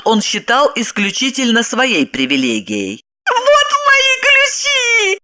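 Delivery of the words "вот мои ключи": very high, drawn-out joyful cry; overjoyed. A narrator's reading. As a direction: cry it out with delighted discovery. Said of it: "обрадовалась Загогулина". (радостный высокий возглас)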